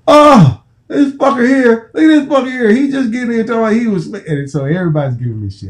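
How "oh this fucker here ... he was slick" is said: much higher mocking teammate voices. Teammates teasing the late player, high mocking jeer. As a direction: razz him in high mock voices. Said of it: "they going".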